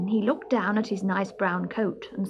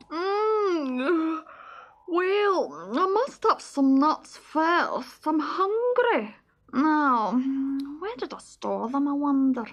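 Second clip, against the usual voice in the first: sleepy squirrel voice; 7 semitones higher, slower than usual. Acted.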